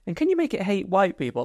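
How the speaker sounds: mock ad exec pitching. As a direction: play a clueless marketing exec asking for changes. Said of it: "imagine the hate monster meeting".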